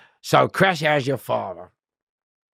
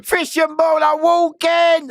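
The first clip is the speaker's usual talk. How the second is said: high, slow drawn-out shout of a guard; slow, high guard's shout; shouted call; 6 dB louder, slower than usual. Retold or imitated. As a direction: bellow it like a screw calling down the wing. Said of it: "they went".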